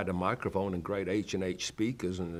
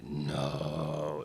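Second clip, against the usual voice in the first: a lightly voiced deep male voice saying no; deep basso profundo voice; 5 semitones lower than usual. Preacher to congregation, imitating a hint of a deep male voice. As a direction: drop low for the mysterious voice, understated. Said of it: "like a deep, basso profundo male voice".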